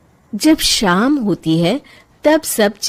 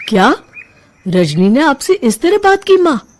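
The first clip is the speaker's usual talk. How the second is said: surprised; 5 semitones higher, 3 dB louder than usual. Acted. Son shocked at mother's news.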